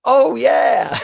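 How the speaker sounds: high, loud, overeager mock "oh yeah"; hammed-up false enthusiasm; fake recognition. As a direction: pretend you know exactly who they mean. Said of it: "then I go".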